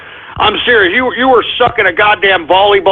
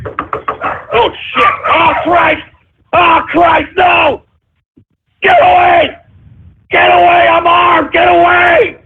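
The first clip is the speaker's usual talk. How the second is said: frightened host yelling at the intruder, get away; panicked shouting; 8 semitones higher, slower than usual. Acted.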